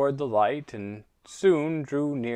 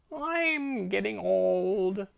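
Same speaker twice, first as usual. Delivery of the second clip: high, slow, drawling cat voice; a sulky, bored old cat; snooty bored cat; 8 semitones higher, much slower than usual. Acted. Mopey cat complains.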